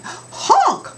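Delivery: very high, loud honk; honk sound. Shown as a demonstration. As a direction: honk like a horn. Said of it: "Help me".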